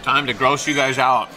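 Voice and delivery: ordinary talk, nothing marked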